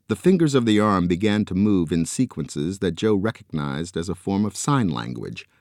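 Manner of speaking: ordinary delivery, nothing marked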